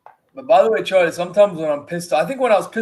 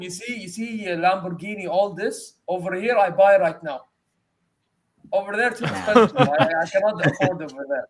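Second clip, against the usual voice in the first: put-on Saudi accent. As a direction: retell it in a mild Saudi accent. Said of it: "I said it with, like, such Saudi accent".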